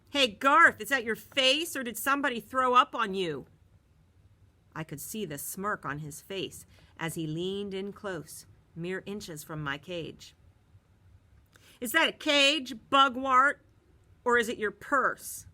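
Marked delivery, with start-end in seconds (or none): 0.1-3.5 loud, high jeering; bully taunting. A narrator's reading. Bully Marty mocks Garth loudly on the bus. 11.8-15.4 loud, high jeering; bully taunting. A narrator's reading. Bully Marty mocks Garth loudly on the bus.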